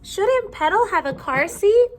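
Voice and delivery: put-on high, anxious child voice, rising at the end; a fretful little mouse asking her parents; worried little girl.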